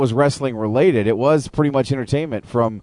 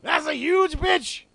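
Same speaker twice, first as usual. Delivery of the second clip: Samuel L. Jackson voice; 4 dB quieter, much slower than usual. Pretend. Jackson beer ad impression.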